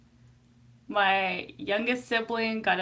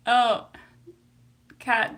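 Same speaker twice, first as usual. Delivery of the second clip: ordinary talk, nothing marked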